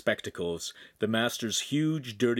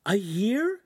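upset; 11 semitones higher, 4 dB louder than usual. A narrator's reading. Shamira crying harder.

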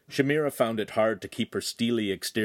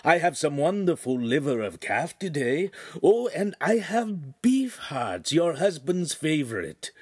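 butcher's sales voice; 7 semitones higher than usual. Acted. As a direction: sell it to her sweetly, like a butcher. Butcher voice in story read aloud.

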